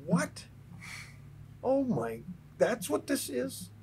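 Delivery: retold awe at a first sight; slow, high disbelief on the realization; amazed. Amazed at seeing gold leaf.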